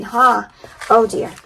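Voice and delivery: usual speaking voice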